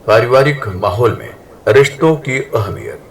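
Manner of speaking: ordinary delivery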